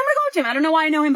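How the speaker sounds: high, urgent mock insistence; insistent. Wife insists despite husband's plea.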